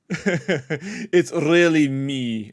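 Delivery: slow, bad fake Russian accent, laughing; lightly done bad Russian accent, drawn out. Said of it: "really bad Russian fake accent".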